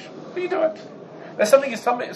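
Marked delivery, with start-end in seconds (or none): 0.0-0.8 high, breathless "What are you doing?" as mild puzzlement; mild surprise. Shown as a demonstration. To someone doing something odd, high airy puzzlement. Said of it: "There are two levels here".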